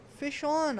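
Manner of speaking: high loud yell; the kid yells to the uncle that a fish bit. A narrator's reading. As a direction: shout it out excitedly. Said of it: "I yell".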